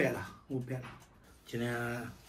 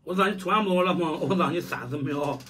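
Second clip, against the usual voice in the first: raised voice; 5 semitones higher, 7 dB louder than usual. Real, not acted. Dad pushing child to finish eating.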